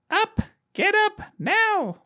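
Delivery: very high, shrill wake-up call; Aunt Petunia. Acted. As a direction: screech it in short, piercing bursts. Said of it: "That was my Aunt Petunia impression".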